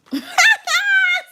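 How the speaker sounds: a high, squeaky giggle; squealing laugh. Real, not acted. Laughs at herself.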